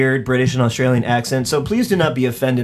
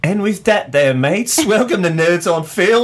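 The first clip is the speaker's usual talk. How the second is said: mock Australian accent; 9 semitones higher than usual. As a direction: greet the mates like a cartoon Australian. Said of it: "To all of our British and Australian".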